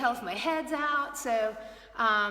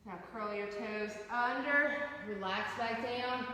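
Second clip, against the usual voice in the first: calm yoga instruction; 8 dB quieter than usual. Acted. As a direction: guide the stretches calmly. Leads a yoga class.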